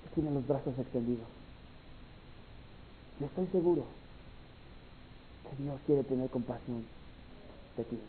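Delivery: low, hushed pleading invitation; intimate, softened preacher's appeal; soft altar call. Acted. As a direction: draw them gently to the front.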